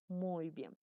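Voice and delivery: ordinary talk, nothing marked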